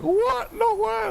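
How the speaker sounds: high falsetto disbelief; hammed-up shocked squeal; mock shocked gamer. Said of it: "and you're like".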